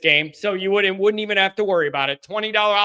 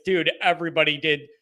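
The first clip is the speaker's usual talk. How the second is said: normal talking voice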